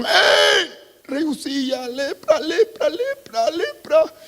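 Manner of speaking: high rising alarmed shouts of lepra; panicked cry. Acted. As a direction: cry out in horror at the disease. Priests crying out at leprosy.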